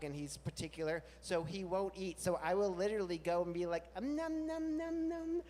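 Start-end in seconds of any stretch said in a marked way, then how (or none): 4.0-5.4 much higher playful nom nom; fake eating noises. Coaxing the anxious dog to eat, much higher playful munching. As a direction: pretend to relish dog food. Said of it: "I will pretend to eat a bowl of dog food".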